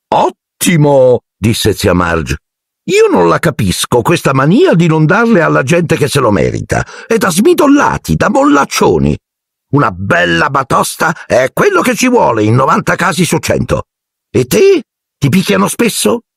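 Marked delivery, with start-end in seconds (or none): 0.1-1.2 high, slow, relishing aunt voice; slow high smug approval; Aunt Marge. A narrator's reading. 2.8-16.2 very high, ranting aunt voice; high scornful rant; Aunt Marge. A narrator's reading. Marge rants about discipline.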